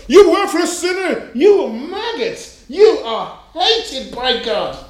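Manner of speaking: hammed-up street preacher yelling condemnation; ranting street preacher. Preacher berating a passer-by, mock ranting. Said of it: "the person who comes out on the street chastising you".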